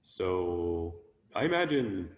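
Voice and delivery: ordinary delivery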